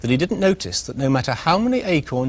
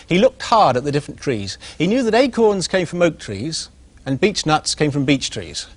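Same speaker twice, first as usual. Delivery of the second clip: ordinary delivery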